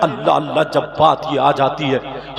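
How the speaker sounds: ordinary talk, nothing marked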